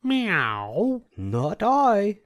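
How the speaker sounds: high meowing cat voice; a cat mewing its refusal. A narrator's reading. Cat refusing to help the hen with a high meow. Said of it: "said the cat".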